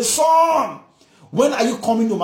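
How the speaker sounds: high, loud calling of a longing father. Acted. Father calling his lost son home loudly. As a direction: call him home from far away.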